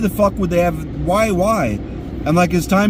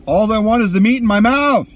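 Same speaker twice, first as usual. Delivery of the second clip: hungry yelling; 7 semitones higher than usual. Real, not acted. Hungry rant alone in car.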